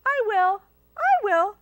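high, loud eager volunteering; eager animal helpers. Acted. Animals offering to do the hen's dishes, high and eager. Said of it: "They each said".

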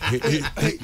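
real laughter breaking through; laughing. Real, not acted. Co-host laughs at the jibe about shouting, laughing. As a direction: laugh out the words.

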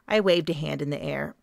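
ordinary delivery, nothing marked

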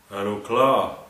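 loud challenge. A narrator's reading. Turbo challenges Eddie.

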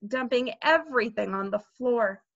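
usual speaking voice

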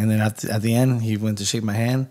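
ordinary talk

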